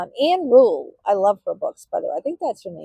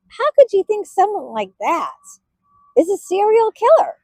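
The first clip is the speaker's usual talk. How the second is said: incredulous; 9 semitones higher, 5 dB louder than usual. Real, not acted. Disbelief that he's a killer.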